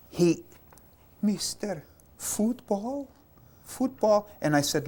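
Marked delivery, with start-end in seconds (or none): 1.2-4.3 higher, softer pleading "Mister, football?"; a little boy begging the soldier, higher and soft. Retold or imitated. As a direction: beg like a hopeful little boy.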